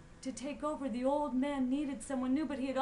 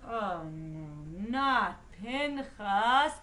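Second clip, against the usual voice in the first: slow, drawn-out groaning dismay; dismayed dread; 2 semitones lower, 7 dB louder, much slower than usual. A narrator's reading. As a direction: drag it out, dreading his arrival. Shopkeepers dread seeing Pinchas.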